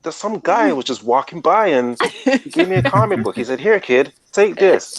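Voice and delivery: hammed-up American hero flashback; American accent. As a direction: play a cheesy American origin-story voice. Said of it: "I don't know why it's an American accent".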